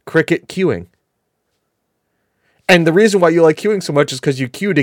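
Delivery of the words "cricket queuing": ordinary delivery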